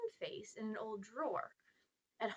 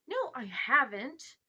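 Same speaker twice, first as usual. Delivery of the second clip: stung, slow protest; indignant; 3 semitones higher, 8 dB louder, slower than usual. A narrator's reading. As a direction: draw it out, loud and affronted. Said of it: "said Diggory indignantly".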